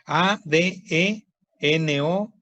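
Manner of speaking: very slow, letter by letter; spelling out. Shown as a demonstration. Teacher spells a new term for students, slow spelling out. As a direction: spell it in measured groups of letters.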